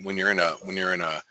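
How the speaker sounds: usual speaking voice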